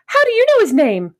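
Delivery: high, loud incredulous question; retold surprise. Child asking the neighbour about the dog, surprised.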